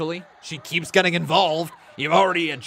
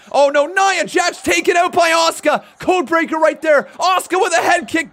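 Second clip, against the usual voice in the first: much higher, loud hype calls; excited shout; 12 semitones higher, 10 dB louder than usual. Real, not acted. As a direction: yell the big move out. Live match call, big moves.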